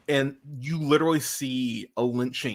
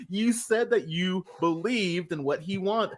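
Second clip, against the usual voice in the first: usual speaking voice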